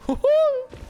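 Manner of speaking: high, loud squeaky "Hoo-hoo!"; Mickey voice. Pretend. Streamer finally slipping into his Mickey voice, squeaky mock cheer. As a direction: burst into a cartoon mouse squeal. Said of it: "my Mickey voice".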